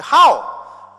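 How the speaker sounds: loud, high, startled "How?"; surprised. Retold or imitated. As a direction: blurt it out in disbelief. Retold shock at his time.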